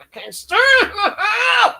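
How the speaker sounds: high, slow, half-crying shout of frustration; aggravated wail. Retold or imitated. She mimics her own on-stream frustration with a crying shout. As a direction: wail it like the slot broke you. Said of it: "You can hear that".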